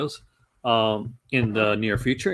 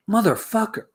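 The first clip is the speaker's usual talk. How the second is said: startled curse; 9 semitones higher, 3 dB louder than usual. Real, not acted. Spills drink, curses loudly.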